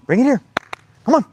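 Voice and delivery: bright high call to dog. Real, not acted. Calling dog.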